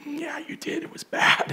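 hushed, voiceless confirmation; mock grave whisper. Daughter telling dad he was bad, imitated whisper. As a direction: whisper it as an awkward truth.

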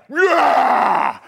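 long, loud, high imitation yeah; exaggerated meathead bellow; mock muscleman roar. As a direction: roar back like a huge lifter. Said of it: "No one has ever talked to me like that".

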